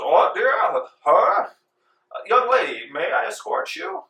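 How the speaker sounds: a bumbling courteous gent; large red-faced financier. Acted. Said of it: "And he goes".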